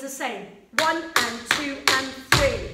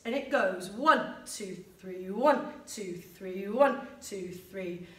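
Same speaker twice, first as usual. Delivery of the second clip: first count punched, the next two dropped very soft; strong and soft; 7 semitones lower, 5 dB quieter than usual. Shown as a demonstration. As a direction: hit the one, let two and three fade almost to nothing. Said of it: "Strong and soft and soft".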